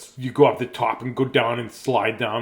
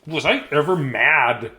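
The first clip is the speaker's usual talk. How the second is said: ordinary delivery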